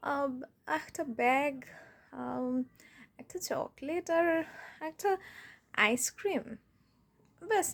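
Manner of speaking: low, soft, lingering request; tender, hesitant wheedling; coy coaxing partner. Acted. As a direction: sweet-talk your partner gently for a present. Coaxing partner for birthday gifts.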